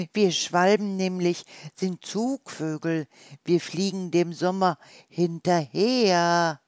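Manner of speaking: a bird explaining its journey south; swallow. Acted.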